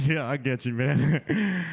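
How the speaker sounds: low, knowing mock listener; a lightly voiced listener going yeah I get you; mock audience voice.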